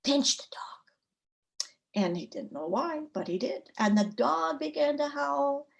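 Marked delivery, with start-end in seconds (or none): 0.0-1.6 slow, drawn-out whisper. A narrator's reading. Woman secretly instructs her husband while whisking, whisper.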